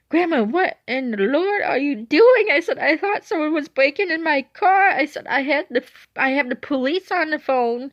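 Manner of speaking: high, loud, indignant telling-off; exasperated scolding. Retold or imitated. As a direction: scold grandma in frantic disbelief. Retold scolding of grandma.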